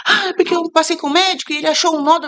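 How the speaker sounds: high-pitched mock panic; imitated despair. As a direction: imitate a distraught friend explaining.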